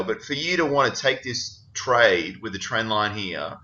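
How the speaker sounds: ordinary delivery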